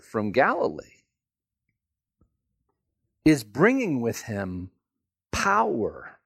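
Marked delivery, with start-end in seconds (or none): none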